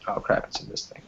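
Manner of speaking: unvoiced mutter to herself; muttered aside. Real, not acted. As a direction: mutter it under your breath. Muttering to herself about mistake.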